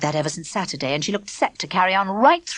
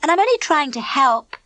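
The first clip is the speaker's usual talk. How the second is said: high, prissy and smug; smug Elaine; 6 semitones higher than usual. A narrator's reading. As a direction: lord it over them with a smirk.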